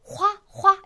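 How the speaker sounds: 화 said with a hard h, a mock wrong sound; wrong pronunciation demo. Shown as a demonstration. 학생들의 흔한 실수를 흉내 낸 과장된 화 발음. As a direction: say 화 with an h, as learners wrongly do. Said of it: "와처럼 발음하시는 분들이 계시는데요".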